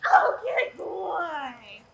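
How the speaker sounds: high, loud, drawn-out dog praise; gushing praise for a good dog; excited praise. Shown as a demonstration. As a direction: stretch it out to delight a dog. Praising dog in training demo.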